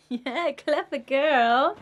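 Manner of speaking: high, slow, drawn-out praise. Real, not acted. Mum praising her toddler's word, high and drawn-out. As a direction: tell a little one they did well.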